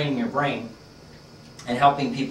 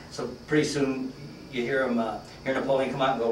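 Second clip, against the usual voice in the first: usual speaking voice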